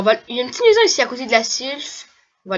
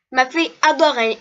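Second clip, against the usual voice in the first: a hint of a girly voice, a little higher; girl voice; 3 semitones higher than usual. Reading an NPC's line, a hint of a girl voice. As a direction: lightly put on a girl's voice. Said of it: "je suis en train de faire une voix de fille".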